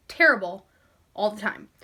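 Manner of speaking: ordinary talk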